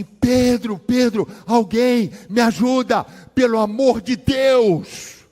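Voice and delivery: high, loud pleading yell, slowing at the end; desperate cry for help. Retold or imitated. Mother bursts into the room screaming for help, desperate and loud. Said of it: "ela tá gritando".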